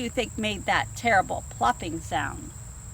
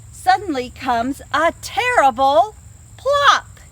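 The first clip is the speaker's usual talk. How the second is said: building to a loud, high PLOP; read-aloud suspense peak; dramatic build; 3 semitones higher, 8 dB louder, slower than usual. A narrator's reading. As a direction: swell up and burst on plop. Climax sound word in story.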